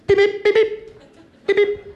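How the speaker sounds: high electronic pip pip beeps; beeping noise. Acted. Comic mimics message alert beeps, high and clipped. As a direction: chirp each pip like a phone alert.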